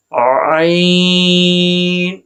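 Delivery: عین held very long from a pressed throat; practice recitation. Shown as a demonstration. Teacher practising عین with students, long and strained. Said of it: "میں آپ کو practice کر کے دکھاتا ہوں".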